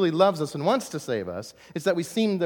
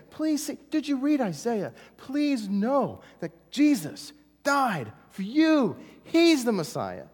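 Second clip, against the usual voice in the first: high pleading, slowed Paul; begging Paul; 8 semitones higher, slower than usual. Retold or imitated. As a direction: plead with them, drawn out. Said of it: "he's begging them".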